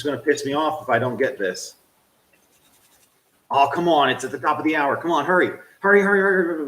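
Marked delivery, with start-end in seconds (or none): 5.0-6.7 getting animated. Real, not acted. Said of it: "now I'm getting animated".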